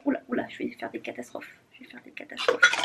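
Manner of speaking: quick nervous whisper. Real, not acted. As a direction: whisper it fast to yourself. Chuchote en déballant. (chuchoté, rapide)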